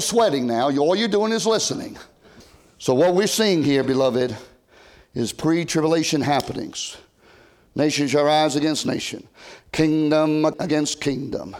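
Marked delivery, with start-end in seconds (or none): none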